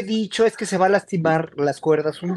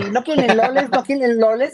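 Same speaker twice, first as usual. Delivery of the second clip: imitating Chinese vendors; 4 semitones higher than usual. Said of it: "nos decían".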